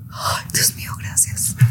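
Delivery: whispered relief. Retold or imitated. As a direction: whisper it like a secret thank-you. Relieved inner thought retold. (susurro aliviado)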